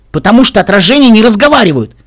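high, loud protest; exasperated outburst. A narrator's reading. Narrator objects to talking reflections, exasperated outburst. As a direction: burst out in disbelief.

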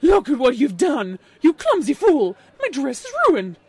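loud, outraged snob; angry shout. A narrator's reading. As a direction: shout in outrage at a clumsy stranger. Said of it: "turned toward the two and shouted".

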